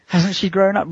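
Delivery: high amazed aside through a laugh; mock-amazed laugh. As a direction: gasp it out half laughing. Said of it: "supermarket going".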